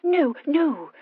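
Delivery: high, impatient protest. A narrator's reading. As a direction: brush the suggestion off. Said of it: "Horatia replied impatiently".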